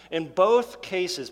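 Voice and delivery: usual speaking voice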